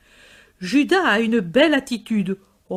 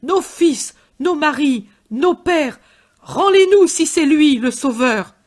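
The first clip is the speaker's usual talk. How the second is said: high, loud demanding shout; clamor for their dead; shouting mob demand; crowd crying out; 5 semitones higher, 5 dB louder than usual. A narrator's reading. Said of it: "Ils crient tous".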